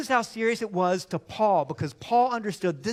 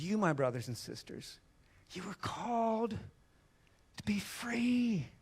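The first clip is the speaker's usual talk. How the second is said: hushed tender reading; 9 dB quieter than usual. Real, not acted. Preacher quoting Paul softly to the church, near whisper. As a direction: breathe the words to be free.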